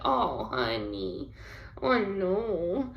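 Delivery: soft, drawn-out commiseration; tender sympathy. Retold or imitated. Wife comforting tired husband, tender sympathy.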